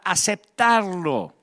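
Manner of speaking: ordinary talk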